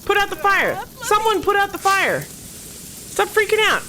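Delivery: very high, loud frantic commands; frantic shouting. Real, not acted. Gamer ordering panicking Sims to fight fire, frantic shouting. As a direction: shriek orders in a flap.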